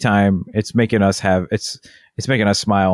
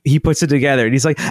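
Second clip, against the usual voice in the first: ordinary delivery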